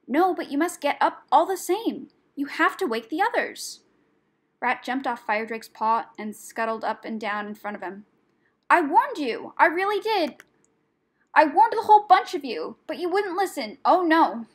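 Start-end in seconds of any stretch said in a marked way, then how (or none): 0.1-3.8 high, loud urging; agitated rat, pitched up; urgent insistence. A narrator's reading. Rat urgently rouses the dragon. 8.7-14.5 high, loud, agitated outburst; worked-up rat, swooping high; frantic scolding. A narrator's reading.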